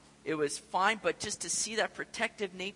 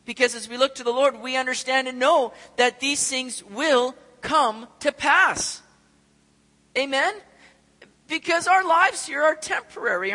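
louder, higher preaching that builds; rising preaching; 5 semitones higher, 8 dB louder than usual. Acted. Pastor rallying the congregation, rising preaching voice. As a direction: lift the congregation, building to full force.

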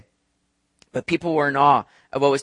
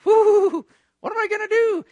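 much higher, falsetto mock panic; sarcastic mock fear. Preacher mocks the cities' pride in a high falsetto mock panic. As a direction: squeal in fake alarm. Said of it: "It's kinda like sarcasm".

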